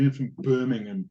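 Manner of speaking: imitating a Birmingham accent. Cohost imitating a Birmingham coworker, Birmingham accent. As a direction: mimic a Brummie saying where he's from. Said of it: "tell me about the Birmingham accent".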